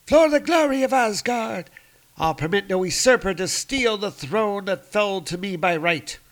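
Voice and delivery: high, loud battle-proud voice; fierce warrior woman. Acted. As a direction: declare it like a warrior queen. Sif's battle cry, voiced.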